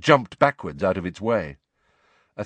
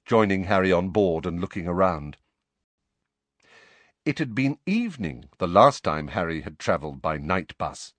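plain reading voice

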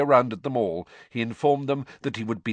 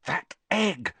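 sharp, high-pitched hiss; 9 semitones higher than usual. A narrator's reading. Hermione reminding Harry about the egg, hissing. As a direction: hiss it pointedly. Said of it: "Hermione hissed".

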